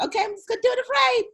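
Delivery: quick high giggly self-mockery; laughing. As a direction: laugh through it, fast and high. Mocking herself, laughing.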